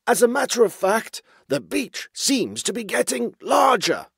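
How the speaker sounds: high, forced-cheerful underling; nervous underling voice. A narrator's reading. Basil hiding the falling water from his chief, high nervous underling voice. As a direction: spin it brightly in a high character voice. Underling spins bad news as good.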